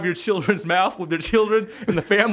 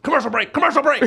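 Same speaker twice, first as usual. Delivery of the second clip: raised, hurried crew alarm; urgent mock call-out; 8 semitones higher, 3 dB louder than usual. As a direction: call for the cameras to cut away. Imitates TV crew cutting away.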